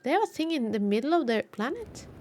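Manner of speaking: usual speaking voice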